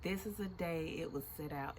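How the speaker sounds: usual speaking voice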